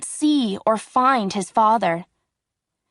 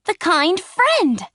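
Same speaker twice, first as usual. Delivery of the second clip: higher, eager cry of recognition; excited cry; 9 semitones higher, 3 dB louder, slower than usual. A narrator's reading. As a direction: burst out, thrilled to see her. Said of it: "he cried".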